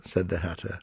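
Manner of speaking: usual speaking voice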